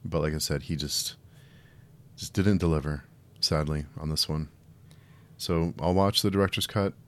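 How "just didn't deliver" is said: ordinary talk, nothing marked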